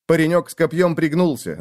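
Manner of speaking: usual speaking voice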